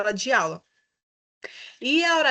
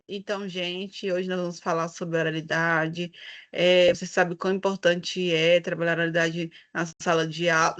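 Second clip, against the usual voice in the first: flat, lower monotone lecture voice; boring teacher; 5 semitones lower than usual. Shown as a demonstration. Teacher shows the class how a dull lesson opening sounds, lower bored monotone. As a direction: drone it out like a dull teacher with no feeling. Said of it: "Imagine quão chato seria eu aqui".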